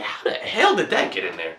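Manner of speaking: high, soft incredulous mutter; disgusted disbelief. Retold or imitated. Smelling it in his nose, high soft disgusted disbelief. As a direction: recoil in grossed-out disbelief.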